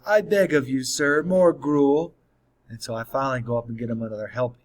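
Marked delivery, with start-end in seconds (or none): 0.0-2.1 slow, higher, louder mock orphan begging; lunch-table joke, mock orphan begging for more; hammed-up begging. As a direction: drag out the plea like a starving orphan in a play. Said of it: "he always goes".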